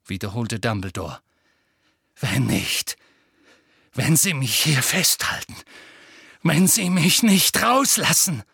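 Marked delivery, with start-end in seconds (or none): 2.2-8.4 building from tense to loud; a boy's threat boiling over; rising threat. Acted. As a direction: threaten him, getting louder.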